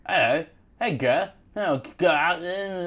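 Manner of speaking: mock Cockney with consonants swallowed; exaggerated, barely intelligible Cockney. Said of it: "maybe it's the Cockney one".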